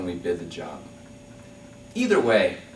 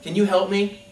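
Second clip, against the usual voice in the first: higher, somewhat louder call from the balloon; 7 semitones higher, 4 dB louder than usual. Acted. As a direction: shout it down, a bit lighter this time. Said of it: "and he shouts".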